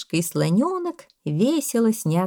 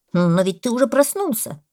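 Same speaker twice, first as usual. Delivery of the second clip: louder, higher insistent parrot; arguing parrot; 5 semitones higher, 5 dB louder than usual. A narrator's reading. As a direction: argue loudly as the parrot. Parrot argues with the boa. (попугай спорит громко, настойчиво)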